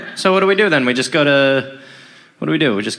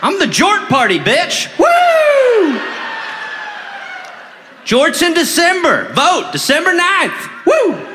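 rowdy shout; 10 semitones higher, slower than usual. Acted. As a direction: whoop like a drunk brother. Rowdy brother campaigning.

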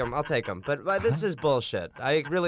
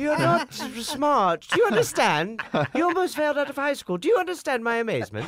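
high, loud, incredulous mother; mom impression; 12 semitones higher, 4 dB louder than usual. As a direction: scold like an amazed mom. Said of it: "Your mom's weird sounding".

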